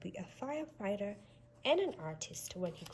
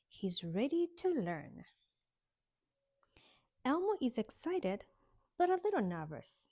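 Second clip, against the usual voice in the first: cheerful picture-book narration; story narration; 3 semitones higher, 4 dB louder than usual. Acted. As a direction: read the storybook pages aloud.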